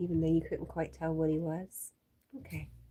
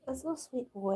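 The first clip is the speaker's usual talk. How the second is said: higher cooing voice for the dog; pet talk; 7 semitones higher, faster than usual. Real, not acted. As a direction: coo at the puppy.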